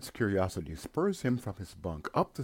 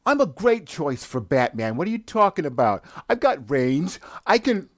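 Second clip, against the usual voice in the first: higher, louder indignant rant; defensive shouting; 9 semitones higher, 8 dB louder than usual. Acted. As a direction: argue hotly with the bird.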